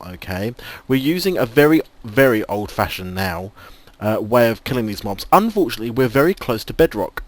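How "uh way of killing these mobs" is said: ordinary delivery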